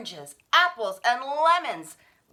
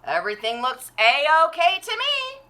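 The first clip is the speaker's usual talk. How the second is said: content policeman; 5 semitones higher, 6 dB louder than usual. A narrator's reading. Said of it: "The policeman said".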